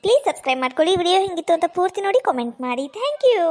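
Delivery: ordinary delivery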